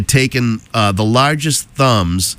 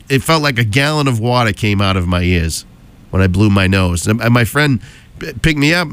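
ordinary delivery

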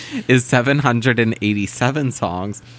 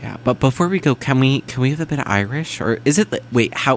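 ordinary talk

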